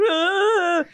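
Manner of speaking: high-pitched imitated bawl; mock crying wail. Student crying under his desk, mock wail. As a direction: wail like an overgrown baby, hammed up. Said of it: "under his desk and crying".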